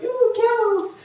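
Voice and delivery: high, loud "ooh" of delight; gleeful high-pitched mock squeal; excited squeal. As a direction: re-enact spotting something thrilling. Retells first sight of camels.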